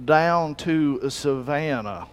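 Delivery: drawn-out Savannah drawl, very slow. Shown as a demonstration. Preacher mimics Savannah speech, drawn out slowly. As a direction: stretch every word like a Savannah drawl. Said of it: "draw out what they're saying".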